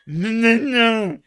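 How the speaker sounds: high, loud wail of no; emphatic refusal. Real, not acted. Man refusing a word he won't use, wailing. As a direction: cry out in protest, drawing it out.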